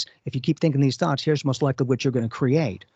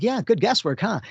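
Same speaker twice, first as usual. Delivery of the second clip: mock modest joke; 7 semitones higher than usual. Joking reply to praise.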